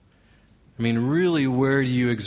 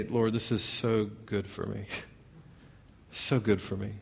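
soft, private prayer; quiet, painfully learned surrender; humble prayer; 2 semitones lower, 7 dB quieter than usual. Acted. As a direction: murmur it to God, accepting the humbling. Acting out humbled prayer to God.

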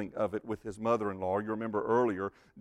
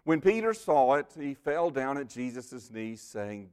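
ordinary talk, nothing marked

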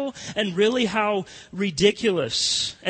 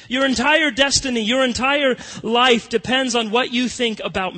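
raised, louder preaching voice; higher, louder pulpit preaching on Jesus' words; preaching at full force; 5 semitones higher, 5 dB louder than usual. Acted. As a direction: build the climax of the sermon.

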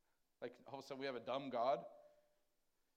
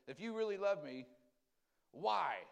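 a hint of a plaintive protest; complaining believer; 4 semitones higher, 5 dB louder, slower than usual. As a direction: ask why like a hurt child. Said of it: "The big why".

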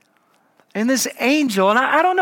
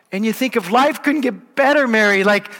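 ordinary talk, nothing marked

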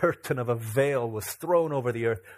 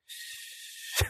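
put-on hushing a crying child; soft, drawn-out shush; soothing shh; 7 dB quieter than usual. Dad calming his crying daughter, soothing shh. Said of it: "I'm, like, calming her down".

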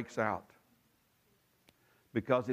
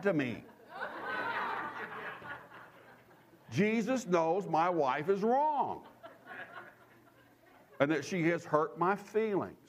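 higher, laughing smug pious voice; hammed-up holier-than-thou husband; mock self-righteous husband; 6 semitones higher than usual. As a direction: parody a man sure Jesus takes his side.

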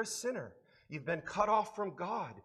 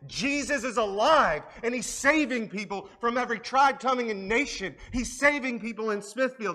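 loud, high, driving sermon climax; full-force preaching; 5 semitones higher, 7 dB louder than usual. Acted. Pastor exhorts the congregation and online viewers, preaching at full force. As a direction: preach it to the back row.

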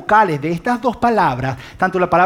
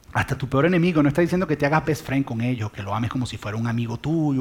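ordinary talk

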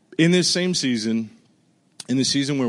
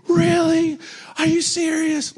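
much higher and louder, excited; thrilled disbelief; 4 dB louder, slower than usual. Retold or imitated. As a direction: jump up in joyful shock at the news. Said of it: "I mean, I was just thrilled".

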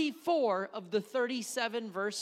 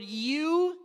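"you" said higher and louder; stress on you; 6 semitones higher, 5 dB louder than usual. Shown as a demonstration. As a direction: lift the word "you" as the key word. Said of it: "The word".

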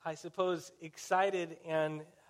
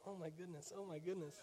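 very soft, under the breath; embarrassed murmur; 14 dB quieter than usual. Retold or imitated. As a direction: say it small, as if hoping nobody notices. Embarrassed grandkids react to screaming grandma.

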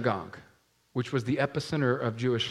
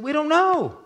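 high, louder "We don't know." with the word "know" dropped off; evasive parents; 7 dB louder than usual. Acted. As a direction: repeat "We don't know." in the same high put-on voice. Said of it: "They said".

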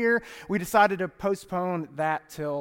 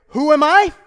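a mother's pointed challenge, pitched up and louder; stern mom; 7 semitones higher, 10 dB louder than usual. Retold or imitated. Mom to back-talking son, stern rhetorical challenge. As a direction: demand it like a mom staring down her kid. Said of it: "and say".

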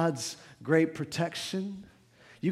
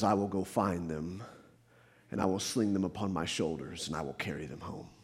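soft tender quote; 10 semitones lower, 9 dB quieter than usual. Acted. Jesus's promise, said very softly.

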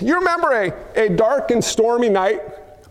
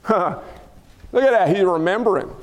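ordinary talk

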